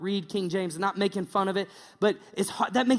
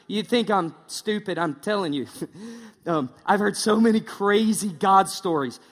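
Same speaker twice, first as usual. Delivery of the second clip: ordinary talk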